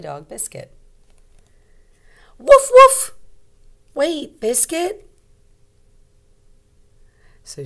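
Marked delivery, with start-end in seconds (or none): 2.4-3.1 very high, loud yappy woof; puppy bark. Acted. Teacher barking as Biscuit the puppy, high and loud. 3.9-5.1 high, slow warning; girl telling puppy to wait. Acted. Little girl telling Biscuit to wait, high and slow.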